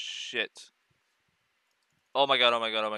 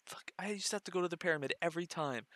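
whisper; 3 semitones higher, 9 dB quieter, much faster than usual. Real, not acted. Whispered aside.

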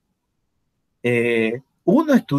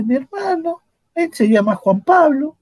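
mock innocent student; 14 semitones higher than usual. Student explains the twin.

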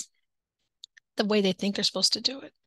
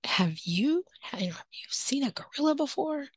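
put-on gentle question to child; 5 semitones higher than usual. Shown as a demonstration. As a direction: ask the child gently like coaxing a shy kid. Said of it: "so you're like".

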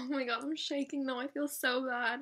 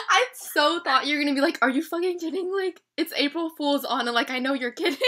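much louder, fast, excited; loud giddy excitement; 10 dB louder than usual. Real, not acted. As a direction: rattle it off overexcited.

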